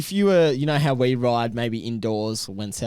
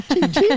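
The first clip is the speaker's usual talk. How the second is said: cha-ching cash sound; 14 semitones higher, 3 dB louder than usual. Pretend. Cash register noise.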